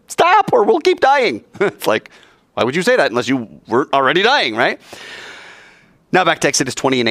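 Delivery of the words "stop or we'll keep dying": higher, pleading people crying out; a hammed-up desperate plea to stop; mock panicked plea. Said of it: "they said".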